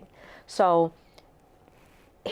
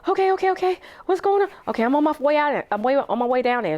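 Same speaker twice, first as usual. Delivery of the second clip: anxious rush; 9 semitones higher, faster than usual. Retold or imitated. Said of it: "phone call quoted".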